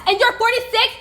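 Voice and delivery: high, loud accusing yell; real angry accusation. Real, not acted. Real heated confrontation on video.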